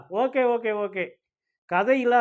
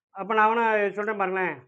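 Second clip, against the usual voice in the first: usual speaking voice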